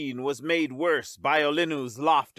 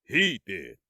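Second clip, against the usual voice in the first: theatrical command; 7 semitones lower than usual. A narrator's reading. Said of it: "his voice rising with theatrical energy".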